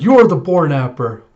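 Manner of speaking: loud mock accusation; a retold loud accusation; accusing yell. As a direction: call him out as the thief. Accusing the boar-napper.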